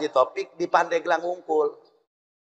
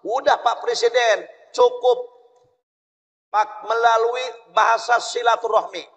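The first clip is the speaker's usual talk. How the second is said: lightly voiced Kiai; 7 semitones higher than usual. Kiai advising Bung Karno.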